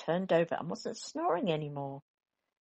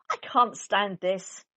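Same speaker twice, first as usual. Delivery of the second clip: slow, fed-up complaint; 3 semitones higher, 5 dB louder, slower than usual. Acted. Mister Bear fed up.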